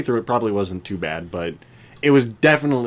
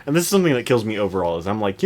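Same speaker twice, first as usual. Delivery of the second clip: ordinary talk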